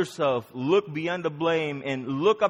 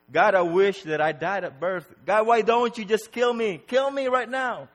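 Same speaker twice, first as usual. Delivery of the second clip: raised, anguished cry to God; despairing plea; 7 semitones higher, 5 dB louder than usual. Acted. Preacher acting out Job wishing for death, despairing plea. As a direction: plead loudly, pitch climbing as you go.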